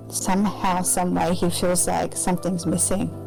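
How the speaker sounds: normal talking voice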